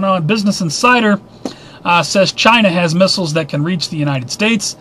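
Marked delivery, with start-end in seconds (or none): none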